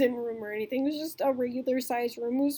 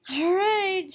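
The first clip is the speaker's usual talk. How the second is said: higher, drawn-out resigned okay; reluctant agreement; 7 semitones higher, 5 dB louder than usual. Retold or imitated. Agreeing to help search, mildly exasperated. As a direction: give in with a sigh. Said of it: "And I'm like".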